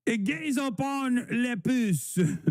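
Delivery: high, slow hammed-up French accent; slow mock French accent. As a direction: drawl it out like a camp Frenchwoman. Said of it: "an old lady pedophile being like".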